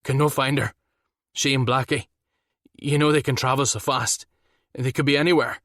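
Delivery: panicked husband, voice trembling; shaking voice. A narrator's reading. Frantic husband phoning for help, voice shaking with fear. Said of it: "Ram's voice was shaking".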